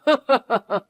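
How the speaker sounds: high, scornful burst of laughter; mocking laugh. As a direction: laugh her accusation off. Mocking her accusation.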